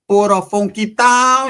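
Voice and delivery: put-on Spanish-accented, high, drawn-out delivery; Spanish version. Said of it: "the Espanol version".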